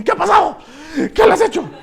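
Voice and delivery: high, loud alarmed shout; alarmed doctors. Retold or imitated. The doctors burst in on the pastor, shouting in alarm. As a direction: cry out in panic.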